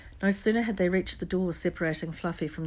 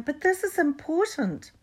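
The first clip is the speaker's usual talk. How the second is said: urgent insistence; 11 semitones higher, 5 dB louder than usual. A narrator's reading. Harry insisting to McGonagall.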